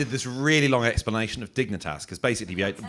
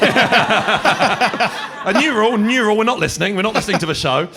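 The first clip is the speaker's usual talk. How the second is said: loud, high, sarcastic mock scolding; mocking retort; 9 semitones higher, 8 dB louder, slower than usual. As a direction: hammer it back at them mockingly. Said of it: "And it was like".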